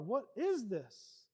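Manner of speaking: drawn out, each word weighted; slow emphatic question. Retold or imitated. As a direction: ask it again slowly and pointedly. Boy repeats his question, slowly.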